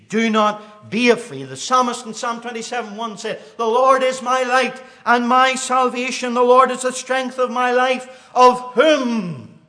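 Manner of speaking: loud, high, ringing pulpit cry; thundering preacher hammering each line; full-force preaching. Acted. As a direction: proclaim it at the top of your voice. Preaching the scripture aloud.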